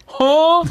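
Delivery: high, loud, stunned "huh?"; gawking "huh". Retold or imitated. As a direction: blurt a high, clueless huh. Acts out gawking at the shooting.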